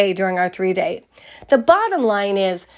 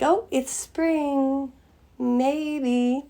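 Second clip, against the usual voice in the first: soft tentative musing; 4 semitones higher, 8 dB quieter, much slower than usual. Retold or imitated. Quoting a hesitant spring feeling.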